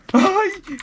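very high loud shriek; real startled scream. Real, not acted. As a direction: shriek when hit by surprise. Egg hit, shriek.

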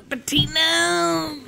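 slow, high shouted call of a name; calling the dog across the room; drawn-out call. Real, not acted.

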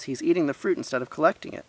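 quick, high-pitched tattling call; hurried indignant call. A narrator's reading. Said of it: "he called to the others".

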